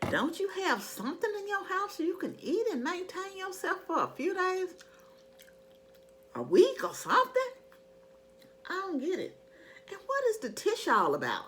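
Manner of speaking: high, exasperated disbelief; incredulous. Real, not acted. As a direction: ask it in total disbelief. Incredulous rhetorical questions to shoppers.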